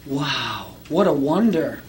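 low, soft awestruck wow; hushed awe. Retold or imitated. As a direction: breathe it out in wonder. People react to the miracle.

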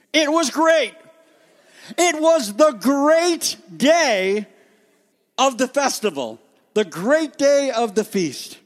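high, loud, drawn-out announcement; booming festival proclamation, high and slow; grand proclamation. Shown as a demonstration. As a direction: proclaim it like a herald, stretching the words. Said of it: "I can't say it like that".

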